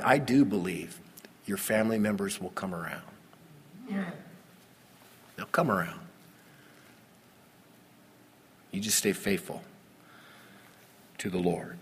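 a quiet, sincere appeal to keep faith; gentle encouragement. Acted. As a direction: ease off and comfort the hurting listener. Tender exhortation.

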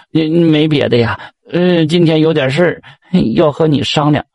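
hesitant hemming. Acted. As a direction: hem and haw before asking a favour. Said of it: "菊花吭吭哧哧".

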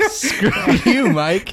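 high laughing screw you; laughing jab. Real, not acted. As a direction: tease him through a laugh. Host laughing at caller.

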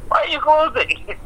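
very high incredulous question; exaggerated bewilderment; baffled disbelief. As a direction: squeak out a mock protest. Acted bafflement at snow closure.

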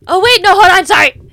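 loud, high, flustered. Real, not acted. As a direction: blurt out a sudden correction. Suddenly correcting herself.

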